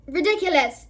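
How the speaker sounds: loud high yell. A narrator's reading. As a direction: yell the spell at the crawling hand. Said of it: "yelled Dean".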